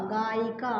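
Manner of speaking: normal talking voice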